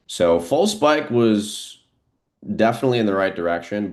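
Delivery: high and loud, dragging out "false spike", then rushing on; excited. Real, not acted. Trader reacting live to the false spike, excited.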